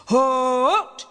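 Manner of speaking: long, loud rising halt; roar. A narrator's reading. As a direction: roar it out a second time. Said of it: "he roared again".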